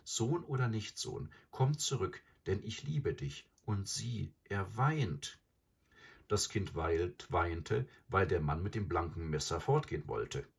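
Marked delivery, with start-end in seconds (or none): none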